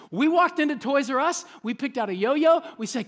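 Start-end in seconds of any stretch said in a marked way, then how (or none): none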